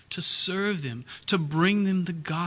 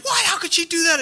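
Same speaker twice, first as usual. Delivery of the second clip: high, louder indignant outburst; Steve Martin angry; 12 semitones higher, 3 dB louder than usual. Retold or imitated. Preacher retelling Steve Martin's outrage at being conned, high indignant outburst. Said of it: "he is angry".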